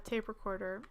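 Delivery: usual speaking voice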